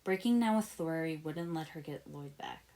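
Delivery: ordinary delivery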